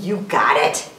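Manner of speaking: ordinary talk, nothing marked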